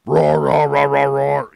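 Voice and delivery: loud repeated growling roars imitating a kid; big playful heavy-metal roaring; mock metal roar. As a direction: belt out a son's metal-voice roars. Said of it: "I'm practicing my ... metal voice".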